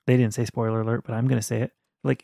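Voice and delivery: ordinary delivery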